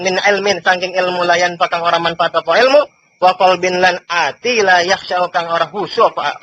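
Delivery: ordinary talk, nothing marked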